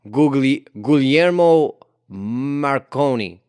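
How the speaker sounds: slow, loud attempt at an Italian accent; halting, drawn-out Italian accent. Shown as a demonstration. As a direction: sound out the name in exaggerated Italian. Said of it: "say it with the Italian accent".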